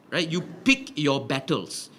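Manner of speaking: normal talking voice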